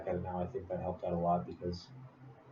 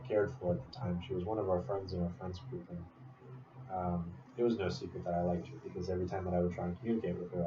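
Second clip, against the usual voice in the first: normal talking voice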